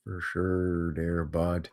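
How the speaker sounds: slow, low, soft Canadian drawl; quiet, slow Canadian echo; soft Canadian accent. Pretend. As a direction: mutter it like a laid-back Canadian. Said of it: "Bunch of Canadians up in here".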